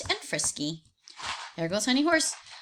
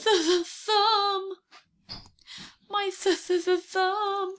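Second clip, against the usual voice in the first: much higher, slow stammering whimper; hurt crying tiger; 11 semitones higher, slower than usual. Acted. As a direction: wail about a squashed thumb. Tiger whimpering about thumb.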